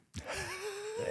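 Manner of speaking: squealing giggle; high laugh. Real, not acted. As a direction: let a high laugh slip out. Laugh after imitation.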